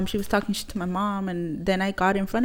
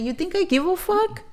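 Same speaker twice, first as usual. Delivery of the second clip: much higher-pitched mock indignation; defiant retort; 11 semitones higher than usual. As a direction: imitate mom scoffing back, pitched way up. Mom's retold defiant retort.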